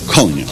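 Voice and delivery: "coño" said low and soft, with the ñ stressed like ny; pronunciation demo. Shown as a demonstration. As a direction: drop low and sound out "coño" carefully. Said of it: "Observe that".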